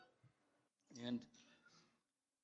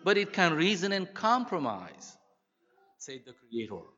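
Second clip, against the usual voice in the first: warm, steady storytelling read; story reading. Acted. Preacher reading a story about mothers to the church, warmly. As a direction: tell the tale to the congregation with feeling. Reads story aloud to congregation.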